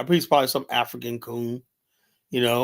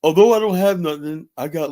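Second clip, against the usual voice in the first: lightly voiced, higher and louder mock of a TV show guest; a hint of a hammed-up TV show voice; mocking TV show talk; 3 semitones higher, 6 dB louder than usual. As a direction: sneer at the humble-brag TV line. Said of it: "you ever see these, these T V shows?".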